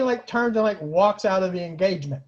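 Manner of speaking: ordinary talk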